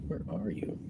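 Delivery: whispered to himself while searching. Real, not acted. Driver whispering while searching for a passenger. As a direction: breathe the question under the breath.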